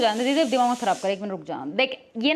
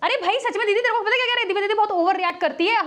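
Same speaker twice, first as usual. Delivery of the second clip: staged tattling; 6 semitones higher than usual. Pretend. Staged quarrel, tattling to sister.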